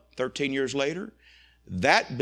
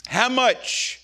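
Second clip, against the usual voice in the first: a pointed, higher, louder "how much"; sharp question; 9 semitones higher, 7 dB louder than usual. Retold or imitated. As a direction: press him hard on "how much". Coach grilling the businessman.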